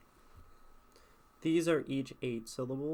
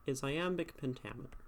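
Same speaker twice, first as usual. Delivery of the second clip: ordinary talk, nothing marked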